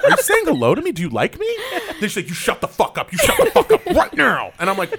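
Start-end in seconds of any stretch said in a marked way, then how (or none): 0.0-1.5 mock bewilderment. Acts his baffled reply to abuse. 2.3-4.5 building to a high, loud mock yell; mock abusive fan. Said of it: "women get very verbally abusive".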